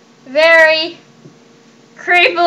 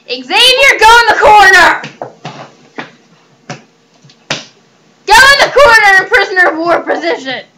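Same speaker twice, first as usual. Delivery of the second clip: higher, louder yell at the pet; angry shout; 4 semitones higher, 6 dB louder, faster than usual. Real, not acted. Owner yelling at Xavier to go to the corner, shouting. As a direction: bellow the order across the room.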